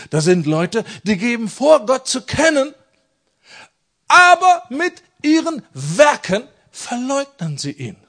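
building fiery denunciation; rising preaching cry. Acted. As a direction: preach it harder line by line until it rings out.

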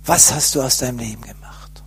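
hushed whisper of a voice inside him; soft, breathless whispered reproach; inner voice whispering. Retold or imitated. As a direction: whisper the question like a voice from within. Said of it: "hörte ich plötzlich eine innere Stimme".

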